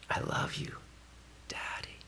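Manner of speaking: whispered, tender and intimate. Real, not acted. Pastor voicing a child's prayer, tender whisper.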